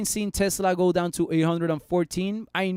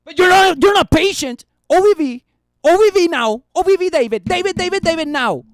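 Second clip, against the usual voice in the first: impatient viewer; 10 semitones higher, 9 dB louder than usual. Acted. Voicing impatient viewer demanding analysis.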